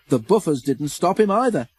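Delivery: ordinary delivery, nothing marked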